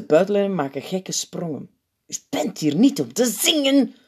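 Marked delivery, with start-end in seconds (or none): 2.1-3.9 high, tense hiss; urgent scolding hiss; hissed reprimand. A narrator's reading. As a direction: hiss it sharply into someone's ear. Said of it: "sist de opperpiet in het oor".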